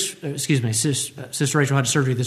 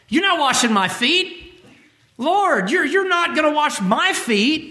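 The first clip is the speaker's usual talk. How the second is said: indignant Peter; 9 semitones higher, slower than usual. Retold or imitated. Peter refusing foot washing.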